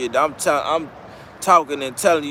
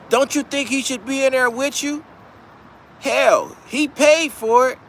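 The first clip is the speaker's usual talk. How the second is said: a much higher put-on proper voice; 6 semitones higher than usual. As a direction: coax him to flip like a slick lawyer.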